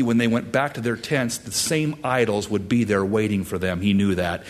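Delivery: ordinary talk, nothing marked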